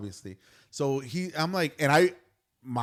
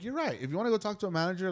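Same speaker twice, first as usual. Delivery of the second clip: high, hurried retold concession; placating rush, high voice; conceding; 6 semitones higher, much faster than usual. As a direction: give in quickly, pitched up. Retold backing down to angry husband.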